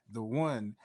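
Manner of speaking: usual speaking voice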